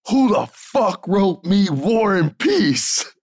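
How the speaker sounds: high, slow, exaggerated angry yell; mock angry outburst. Big guy storming in, mock angry at the writer. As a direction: burst in demanding who wrote the script. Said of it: "all I hear is".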